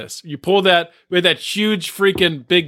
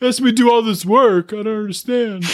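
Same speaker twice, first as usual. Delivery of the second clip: higher-pitched mock helpless voice; hapless helper impression; 4 semitones higher than usual. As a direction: play a put-upon bumbler, hammed up. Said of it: "he's doing a terrible job, just like".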